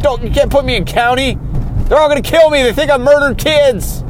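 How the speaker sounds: high-pitched frantic plea; imitating a terrified suspect begging; scared pleading. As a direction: beg not to be sent to county. Voicing scared jailed suspect pleading.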